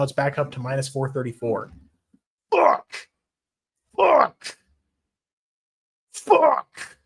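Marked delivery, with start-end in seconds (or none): 2.5-7.0 high, loud bursts of exasperation; frustrated yelps. Real, not acted. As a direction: yelp the curse in sharp frustration. Frustrated swearing over betting odds.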